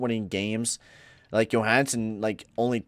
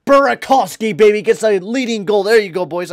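much higher, louder goal celebration; excited cheer; 10 semitones higher, 12 dB louder than usual. Real, not acted. Gamer cheering his team's go-ahead goal, excited shout. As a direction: yell with joy at the goal.

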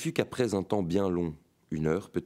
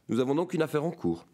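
higher, eager cry; eager exclaiming, higher pitch; 7 semitones higher than usual. A narrator's reading. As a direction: jump at the deal. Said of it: "s'écria Bouir".

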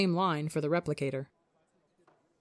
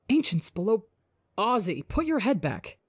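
higher, pleading whine; whining; 4 semitones higher than usual. Acted. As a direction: whine it in disgust. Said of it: "Ranbu whines".

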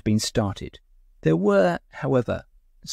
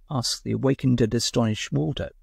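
plain reading voice

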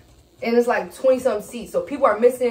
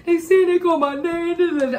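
imitating crying; 7 semitones higher than usual. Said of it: "I'm crying".

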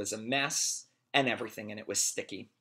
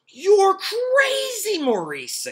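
put-on excited boy voice; 10 dB louder, much slower than usual. Todd voiced in story.

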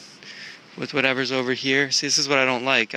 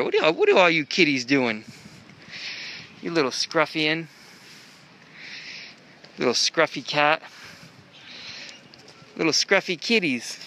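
cooing to cats; 3 semitones higher than usual. Real, not acted. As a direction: coax the scruffy kitties gently. Talking to stray cats.

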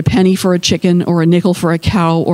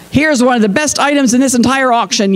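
fast, higher-pitched sales call; raised-pitch auctioneer's pitch; auctioneer yelling; 6 semitones higher, faster than usual. A narrator's reading. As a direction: rattle it off like an auctioneer. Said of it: "yelled the man in the big hat".

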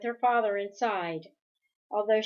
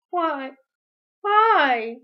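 anguished; 6 semitones higher, 9 dB louder than usual. Acted. Meg's anguished plea.

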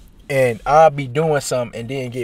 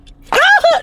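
loud laugh; 5 dB louder than usual. Real, not acted.

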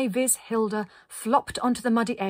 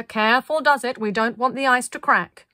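loud, cautioning call; cried out; 4 semitones higher, 7 dB louder than usual. A narrator's reading. Linda warning the group about the ice, calling out. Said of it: "cried Linda".

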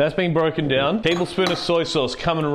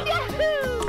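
squeaky high whoop before a shot; high yahoo cry; 5 dB quieter than usual. Real, not acted. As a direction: yelp it up high. Shot-taking ritual cry.

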